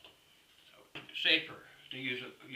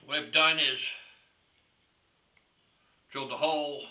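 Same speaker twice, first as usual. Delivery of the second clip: usual speaking voice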